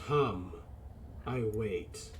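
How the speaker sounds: low mechanical god voice; slow deep machine voice; booming mechanical voice. Acted. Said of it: "hear the booming mechanical voice".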